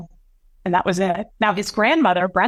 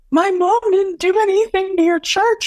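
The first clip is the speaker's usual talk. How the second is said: high, louder, tear-choked protest; imitating a desperate sobbing plea; 12 semitones higher, 3 dB louder than usual. Son defending mom to grandfather, high tearful outburst. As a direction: cry it out, pitched way up. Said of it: "it was so heart-wrenching".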